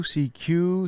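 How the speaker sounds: ordinary talk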